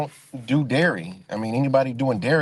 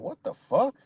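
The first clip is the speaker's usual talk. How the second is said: incredulous; 9 semitones higher than usual. Retold or imitated. Reacting to played voice message.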